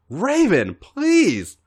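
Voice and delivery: high, begging plea, voice lifting on please; pleading. Acted. AJ begging Raven, high pleading cry. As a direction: beg him, rising high. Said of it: "A J pleaded".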